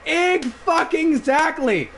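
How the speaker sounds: "exactly" broken up, high and drawn out; emphatic agreement. Retold or imitated. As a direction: stretch "Egg fucking exactly" slowly for emphasis. Acted emphatic agreement in story.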